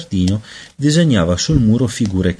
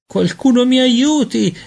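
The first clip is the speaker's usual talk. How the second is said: put-on much higher, slow desperate baby cry; crying for help; 4 dB louder than usual. A narrator's reading. Peter trapped in baby body, crying out in panic. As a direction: beg for help like a frightened child. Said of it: "gridò".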